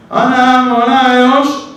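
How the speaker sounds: high, loud, drawn-out terrified chant; slow sung-out yelp of panic; panicked cry. Retold or imitated. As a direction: cry out to God in sudden fright. Panicked prayer on seeing snake.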